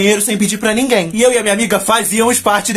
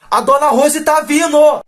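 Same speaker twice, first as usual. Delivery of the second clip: retold shout; 6 semitones higher, 3 dB louder, slower than usual. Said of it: "alguém gritou assim".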